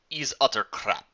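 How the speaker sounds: put-on Russian accent, drawling out her disappointment; slow Russian drawl with a sigh of disgust. A narrator's reading. Talia dismissing the producer's cards, Russian drawl. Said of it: "The drawl of her Russian accent".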